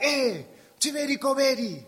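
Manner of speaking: high, loud mock hail to a friend; calling out. Friends greeting the man back from Philadelphia, calling out. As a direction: call the name out high and loud.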